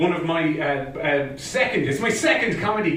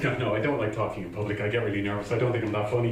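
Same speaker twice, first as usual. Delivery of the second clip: lower, softer, quick excuse; reluctant refusal; 4 semitones lower, 5 dB quieter, faster than usual. Retold or imitated. Refusing the pushy friend, quietly reluctant. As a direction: back out quietly, imitating a reluctant self.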